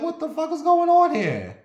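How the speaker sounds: very high incredulous outcry; acted high-pitched alarm; alarmed disbelief. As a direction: re-enact a shocked bystander, pitch way up. Alarmed bystander at beating, retold.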